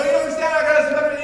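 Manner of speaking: very loud, high, pleading; loud desperate plea. Retold or imitated. Dad pleading with the ticket seller, loud and high. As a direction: beg with mock self-importance.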